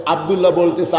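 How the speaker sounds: normal talking voice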